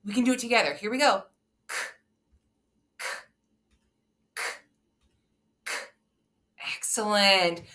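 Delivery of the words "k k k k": K repeated as separate voiceless bursts; isolated phonics K, no vowel, evenly spaced; sharp letter sound. Shown as a demonstration. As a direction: tap out sharp K sounds with long gaps between. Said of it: "make the letter C sound so sharp".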